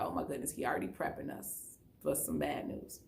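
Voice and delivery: breathless whisper of a frightened thought; scared whisper. Retold or imitated. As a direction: whisper it to yourself as if dreading bad news. Scared inner thought, I was like.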